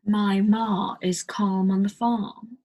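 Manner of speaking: slow, whispered British accent, long ah in "farm"; slow British demo. Shown as a demonstration. Teacher slowly demos the British ah to learners. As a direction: draw out the ah in "farm". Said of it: "farm is like F A".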